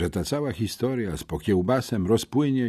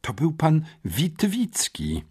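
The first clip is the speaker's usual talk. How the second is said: slow, higher, measured correction; drawled through teeth; 5 semitones higher, slower than usual. A narrator's reading. Headmistress correcting pupil about the teacher, slowly drawling. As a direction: spell it out with strained patience. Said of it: "wycedziła magnificencja".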